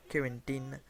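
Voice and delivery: accent anglais essayé sur « Quarantine », vite abandonné; accent anglais raté. Shown as a demonstration. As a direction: dis « Quarantine » à l'anglaise, sans conviction. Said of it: "je vais pas faire l'accent anglais, ça le fait pas". (« Quarantine » avec un accent anglais maladroit)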